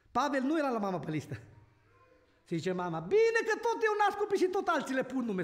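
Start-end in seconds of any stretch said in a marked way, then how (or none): 3.0-5.4 high, sharp mother's complaint; a preacher voicing his upset mother, indignant and raised. Scene: mother protests that others keep naming her children, indignant. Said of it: "S-o necăjit mama".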